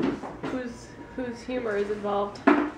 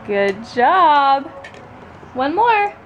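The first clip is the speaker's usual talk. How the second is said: excited praise; 5 semitones higher, 4 dB louder, slower than usual. Real, not acted. Praising baby, high and loud.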